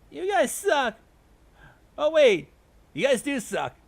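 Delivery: high, loud jeer; heckling. A narrator's reading. Heckler insulting the rival team, high and loud. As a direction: jeer loudly across the room. Rival sides trade jeers.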